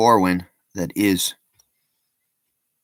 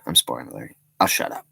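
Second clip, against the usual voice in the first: ordinary talk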